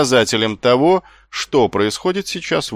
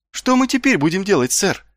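high, anxious question; alarmed; 10 semitones higher than usual. A narrator's reading. Marko asks his captain what to do, alarmed. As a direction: ask with worry, pitch raised. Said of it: "встревоженно спросил Маркоу".

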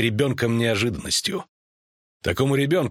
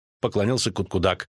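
plain reading voice

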